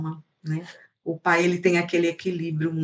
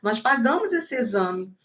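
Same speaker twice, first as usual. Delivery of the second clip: indignant protest; 6 semitones higher than usual. Pretend. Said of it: "eu ia falar na mesma hora".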